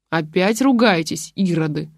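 usual speaking voice

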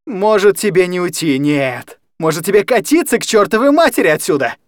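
high, loud furious rant. Acted. Kostya's sister raging at the guardian's woman, furious rant. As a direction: lose all control at the woman. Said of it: "Разорялась Костина сестра".

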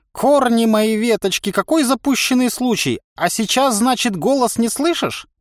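cackling toothless ranting. Acted. Old man ranting.

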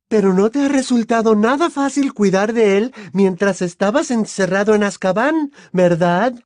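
high, loud, cutting mother voice; scornful mother. Acted. Mother taunting the godfather over his prison time, scornful.

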